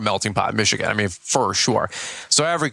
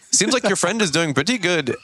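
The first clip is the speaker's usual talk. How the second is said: higher-pitched imitated Indian accent; 7 semitones higher than usual. Customer comments on the irrigation guy to the contractor, imitated Indian accent. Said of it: "in his best Indian voice".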